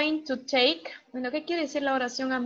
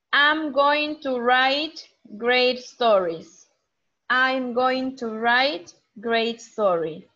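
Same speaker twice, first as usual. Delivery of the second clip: slow clear model reading; 5 dB louder, slower than usual. Shown as a demonstration. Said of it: "Which profession is it?".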